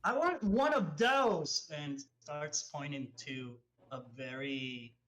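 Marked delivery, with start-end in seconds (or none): 0.0-1.7 high, loud, slow character voice; drawn-out, high-pitched request at the bar; Vivi. Acted. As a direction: order eagerly in a high put-on voice. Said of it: "Vivi goes".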